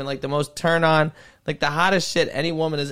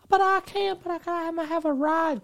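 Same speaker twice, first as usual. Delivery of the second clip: much higher mock girl voice; 13 semitones higher than usual. Retelling the poor girl's excuse, much higher. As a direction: pitch it way up like her.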